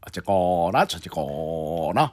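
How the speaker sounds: low, slow comedian drawl; lightly voiced comedian; Choi Yang-rak impression. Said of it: "최양락 씨".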